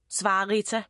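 plain reading voice

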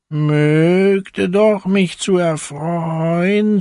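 a hungry little hen musing slowly; hen's voice. A narrator's reading. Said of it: "sprach es".

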